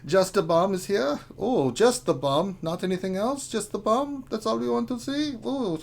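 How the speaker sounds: put-on leering, playful German guy accent; hammed-up, flirty German accent. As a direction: read the roll call in a suggestive German accent. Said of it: "as the German guy".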